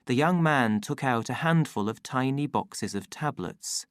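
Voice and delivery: plain reading voice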